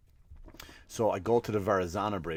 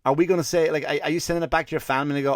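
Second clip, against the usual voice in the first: ordinary talk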